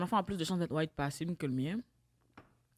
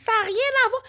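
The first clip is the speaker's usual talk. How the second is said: much higher mock voice of a dismissive friend; mocking Linda; 7 dB louder than usual. Retelling Linda's dismissive answer in a high mocking voice. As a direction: parody her dismissive reply. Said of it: "Elle me dit".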